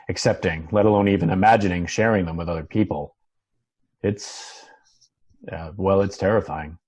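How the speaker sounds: musing to himself off-screen; voiceover. Acted. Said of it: "Muff, voiceover".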